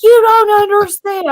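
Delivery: high, loud mock whine; whiny sister. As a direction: mimic the sister insisting. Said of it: "I'm like".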